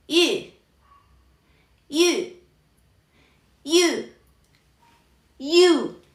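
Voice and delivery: high falling y with puckered lips; rounded-lip demo. Shown as a demonstration. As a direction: pucker as for a kiss and say y as ü. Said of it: "nuestra boca en esa posición". (y con labios de besito, agudo)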